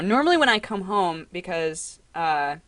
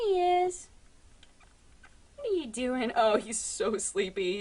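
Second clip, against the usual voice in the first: soft cooing; 4 semitones higher, 7 dB quieter than usual. Real, not acted. Cooing at her cat.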